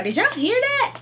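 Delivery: squeaked up high in disbelief; high shocked squeal to the viewers. Real, not acted. Vlogger reacting to the heavy book blurb, squealing up high.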